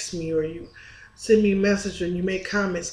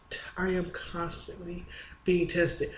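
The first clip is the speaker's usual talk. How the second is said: whisper; 6 dB quieter than usual. Real, not acted. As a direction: drop to a whisper on the last line. Confiding hardship at the end.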